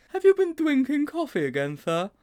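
a puzzled schoolboy asking his teacher; high, wide-open boy's question; Sponge's voice. A narrator's reading.

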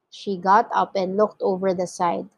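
ordinary delivery

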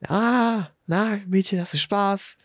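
high, fake-sweet cooing at a child; mock-friendly man. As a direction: imitate a seething man playing nice. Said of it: "umgedreht und meint so".

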